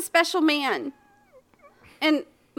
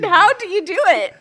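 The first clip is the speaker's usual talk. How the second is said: "How" punched louder than usual; stress on HOW; 3 semitones higher, 7 dB louder than usual. Shown as a demonstration. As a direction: hit "How", asking for the method. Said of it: "it sounded like I was asking".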